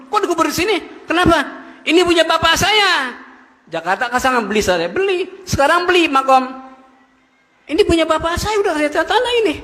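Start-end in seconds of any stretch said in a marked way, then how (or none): 0.1-3.2 high, indignant protest at the grave; protesting stranger. Retold or imitated. 7.6-9.5 high, indignant claim of ownership; insistent claimant. Retold or imitated. Claimant insists on grave plot.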